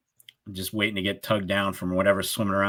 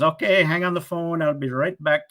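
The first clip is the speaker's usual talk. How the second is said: imitating the scammer; 8 semitones higher than usual. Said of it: "He goes".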